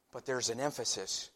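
ordinary talk, nothing marked

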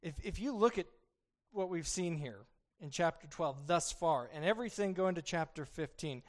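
ordinary talk, nothing marked

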